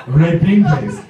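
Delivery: put-on Japanese-accented confirmation; Japanese coworker. Japanese coworker confirms his translation.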